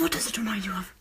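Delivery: quiet whispered question; whispering. Pretend. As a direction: hush it back conspiratorially. Whispered reply.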